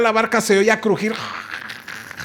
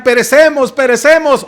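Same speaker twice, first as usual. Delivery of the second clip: high, loud cry of doomed men; panicked cry; 6 semitones higher, 5 dB louder than usual. Acted. Disciples crying out to Jesus in the storm, panicked shout. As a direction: shout it like sailors sinking.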